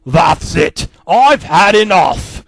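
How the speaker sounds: loud, slow fed-up yell. Acted. Daddy Pig yelling that he has had enough. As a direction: bellow it out, slowly. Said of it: "as Daddy yelled".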